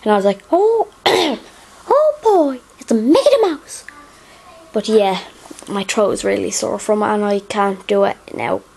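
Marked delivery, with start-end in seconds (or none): 0.5-3.8 very high, slow squeaky cartoon mouse; Mickey Mouse voice. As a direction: do the Disney mouse, stretched out and high. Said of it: "this Mickey Mouse kinda thing".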